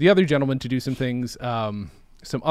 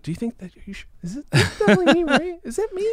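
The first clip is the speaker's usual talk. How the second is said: high, laughing, anxious questions; 12 semitones higher, faster than usual. Retold or imitated. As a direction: plead for reassurance through laughter. Asking friends, laughing.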